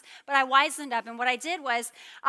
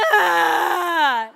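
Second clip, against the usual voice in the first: loud wordless scream of protest; imitated toddler scream; 4 semitones higher, 11 dB louder than usual. Mother imitating daughter screaming at brother's song. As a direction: shriek like a woken child. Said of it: "She goes".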